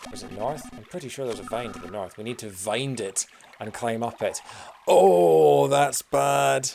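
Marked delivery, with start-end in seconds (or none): 4.9-6.7 mock anguish. Groaning at own pun.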